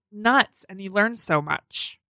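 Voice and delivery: ordinary delivery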